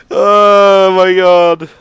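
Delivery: loud, high, drawn-out exclamation; slow, delighted cry after laughing; overjoyed. Real, not acted. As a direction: stretch it out in breathless glee. Cracking up at the game.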